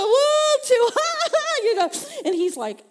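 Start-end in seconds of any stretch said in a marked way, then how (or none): 0.0-0.6 very loud, high wailing "woo"; hammed-up boo-hoo; mock wail. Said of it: "I just went from". 0.9-1.6 high, loud burst of ha-ha; hammed-up hysterical laugh; imitated laughter. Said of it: "I bust out laughing".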